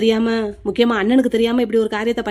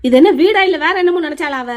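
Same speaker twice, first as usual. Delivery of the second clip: loud, high angry shout; angry outburst; 5 semitones higher, 5 dB louder than usual. Acted. Brother angrily shouts about the wailing in the house, loud. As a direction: snap at the whole house.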